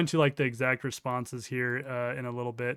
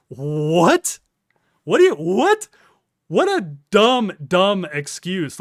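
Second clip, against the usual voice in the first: loud high disbelieving what; incredulous; 4 semitones higher, 11 dB louder, much slower than usual. Real, not acted. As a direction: shout in disbelief, then slow scorn. Incredulous at dumb excuse.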